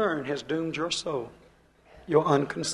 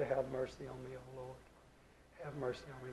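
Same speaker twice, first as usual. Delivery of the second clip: hushed, much softer plea; pleading for mercy; 4 semitones lower, 19 dB quieter than usual. Acted. A believer at Jesus' feet, softly begging mercy. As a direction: beg for mercy almost under the breath.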